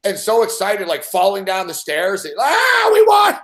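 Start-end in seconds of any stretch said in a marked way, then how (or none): 2.4-3.4 very high, loud celebratory yell; triumphant scream. Retold or imitated. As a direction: shriek it from the stairs. Drunk crowd celebrating.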